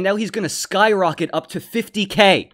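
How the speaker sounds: loud, high-pitched hype-man announcing; excited hype. Real, not acted. Streamer promising viewers a comeback to fifty K, loudly hyped. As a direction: build it up big, loud and high. Hyping the gambler's coming comeback.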